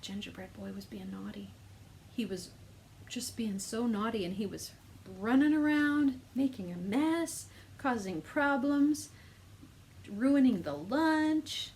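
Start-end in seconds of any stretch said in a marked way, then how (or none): 5.2-11.7 higher, louder and slow, building drama; dramatic excited telling. Acted. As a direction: play up the mischief for kids. Teacher acts out naughty boy.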